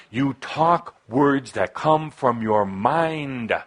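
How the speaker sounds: usual speaking voice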